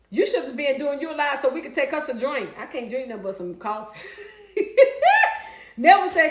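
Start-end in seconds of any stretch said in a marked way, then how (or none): 4.6-5.5 sudden high laugh; a real high laugh; high burst of laughter. Real, not acted. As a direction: laugh out loud at your own joke. Laughs at her own drinking joke.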